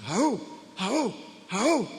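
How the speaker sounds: mock warrior barks; Spartan war chant. Soldiers answer their king, mock warrior barks. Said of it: "Y aquellos nomás gritan".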